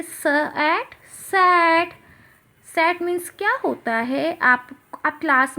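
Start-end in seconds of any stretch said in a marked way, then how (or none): 0.0-1.9 slow, raised "स", "at", then "sat"; teacher slowly joining "स" and "at" into "sat"; phonics blending. Shown as a demonstration. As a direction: sound out "स" and "at", then stretch "sat". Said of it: "क्या बन गया ये?".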